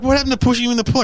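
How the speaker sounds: high, fast incredulous voice; mock comedians. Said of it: "they're like".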